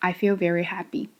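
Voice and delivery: quick, low, unenthusiastic delivery; unconvincing happiness. Shown as a demonstration. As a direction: claim happiness without any conviction. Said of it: "Do you think people will believe you".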